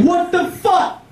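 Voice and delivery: high, slow, stunned; shocked. Retold or imitated. Comic sees his river dug up, asks in high, slow shock. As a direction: stretch it out in disbelief.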